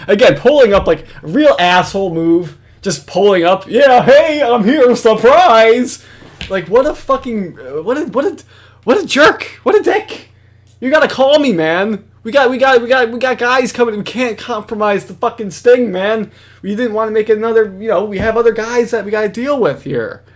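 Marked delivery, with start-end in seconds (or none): 3.7-6.0 loud, high-pitched fake cheer; mock grand arrival. Host mimicking Jim pulling up as a surprise, mock-excited. 6.5-20.2 high-pitched, flustered indignation; exasperated rant. Real, not acted. Host scolding Jim for compromising the sting, exasperated. Ranting at Jim for just arriving.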